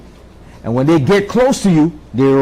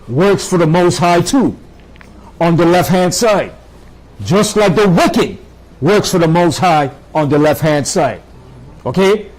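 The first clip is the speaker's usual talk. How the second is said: normal talking voice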